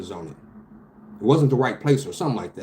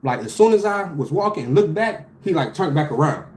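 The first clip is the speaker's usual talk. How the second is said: higher, rushed and keyed up; a spooked rush, pitched up; alarmed retelling; 6 semitones higher than usual. Real, not acted. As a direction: tell it like it still scares you. Retelling being followed, alarmed.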